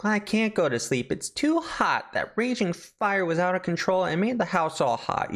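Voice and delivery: put-on squeaky child voice; little girl voice. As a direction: complain like a child who can't sleep. Little girl whining to mom.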